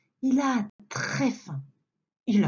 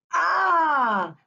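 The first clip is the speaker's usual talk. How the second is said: wolf's pouncing shout; 7 semitones higher, 4 dB louder than usual. Acted. Loup bondit en criant.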